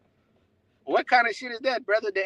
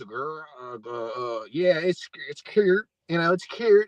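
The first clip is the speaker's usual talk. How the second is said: mocking her interview; 4 semitones lower than usual. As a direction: parody her halting interview talk. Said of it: "repeat her words".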